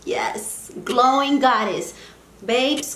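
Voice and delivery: ordinary talk, nothing marked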